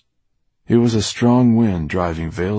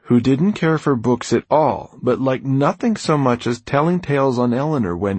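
usual speaking voice

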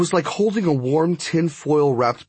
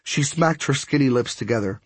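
usual speaking voice

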